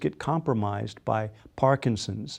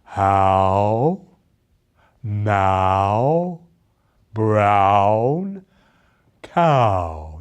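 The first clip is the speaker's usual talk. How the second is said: low, louder, drawn-out stage diction; actor's elocution; 4 semitones lower, 5 dB louder, much slower than usual. Shown as a demonstration. Coach imitates actors doing the vowel drill, low and louder. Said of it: "actors saying".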